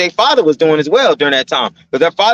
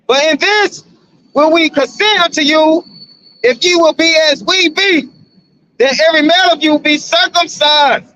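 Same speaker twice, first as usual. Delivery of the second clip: clearly higher, declaiming verse voice; raised solemn King James reading; scripture reading; 5 semitones higher than usual. Acted. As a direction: proclaim the verse like from a pulpit. Reads scripture aloud.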